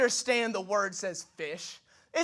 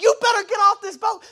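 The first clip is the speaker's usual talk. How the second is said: angry order; 10 semitones higher, 8 dB louder than usual. Pretend. Sailors ordering Jonah off.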